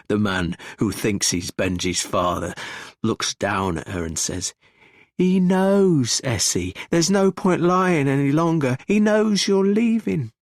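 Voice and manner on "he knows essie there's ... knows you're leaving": high, loud, insistent; forceful confrontation. A narrator's reading. Man tells her the secret is out, forcefully. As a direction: raise the voice and press each point home.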